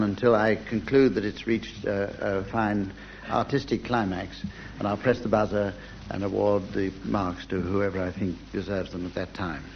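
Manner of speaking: ordinary talk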